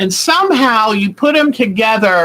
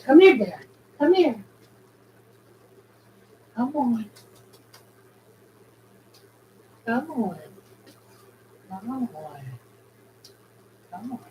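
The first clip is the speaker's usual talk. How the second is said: soft coaxing; 8 dB quieter, faster than usual. Real, not acted. Coaxing the bird to her, softer and softer. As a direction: coax gently as you walk away.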